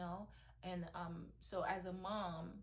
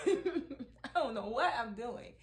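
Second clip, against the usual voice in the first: louder and slower, laughing it out; laughing loudly; 5 semitones higher, 10 dB louder, slower than usual. Real, not acted. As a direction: laugh the words out, giving up.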